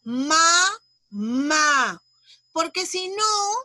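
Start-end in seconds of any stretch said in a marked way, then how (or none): 0.0-2.0 second "ma" hit with more intensity; "ma, ma", the final A pushed out strong; stress on second MA. Shown as a demonstration. Teacher shows Lía what the accent mark does, stressing the last "ma". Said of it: "se escuche con más intensidad".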